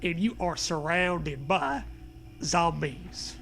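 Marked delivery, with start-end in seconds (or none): none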